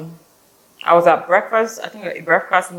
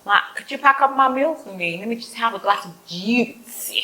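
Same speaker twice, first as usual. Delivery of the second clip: sweet polite request; 6 semitones higher than usual. Retold or imitated. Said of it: "So I was like".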